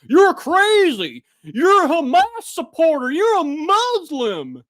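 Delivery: high, loud, slow mocking accusation; mocking accusers. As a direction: parody the people yelling at him. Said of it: "People are like".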